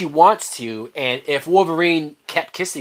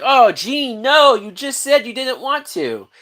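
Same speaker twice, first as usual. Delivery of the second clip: higher mock-scolding "oh Jean, no"; 11 semitones higher, 3 dB louder than usual. Viewer scolding the character on screen, mock dismay. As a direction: tease her like a let-down fan. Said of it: "you're going like".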